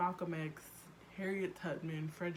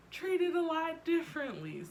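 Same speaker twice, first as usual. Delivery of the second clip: much higher and louder exclamation; emphatic burst; 11 semitones higher, 9 dB louder than usual. Real, not acted. Youngest child complains to viewers, loud high burst. Punchline after whisper.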